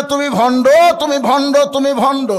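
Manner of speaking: loud, high repeated shout; high acted yell, hammering each repeat; shouted accusation. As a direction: hurl the accusation three times. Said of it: "রাখাল চিল্লায় বলে".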